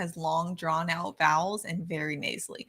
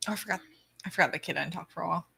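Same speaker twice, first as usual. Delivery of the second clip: ordinary talk, nothing marked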